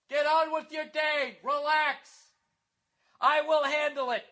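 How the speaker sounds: hammed-up official addressing the masses; pompous self-appointed spokesman. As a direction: proclaim it like a self-important town crier. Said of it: "they act like they're standing in front of, like, a zillion people".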